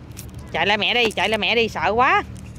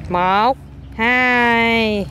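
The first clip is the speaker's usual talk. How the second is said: "Một, hai" with "hai" held very long; counting demo. Shown as a demonstration. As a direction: count slowly, stretching "hai" for the child to follow. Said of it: "con phải đếm một". (đếm mẫu, kéo dài "hai")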